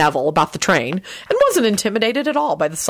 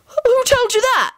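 very high, stretched-out question; high-pitched affronted question; indignant demand; slower than usual. A narrator's reading. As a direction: demand it in disbelief. Bully demands who told him.

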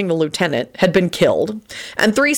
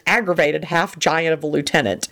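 usual speaking voice

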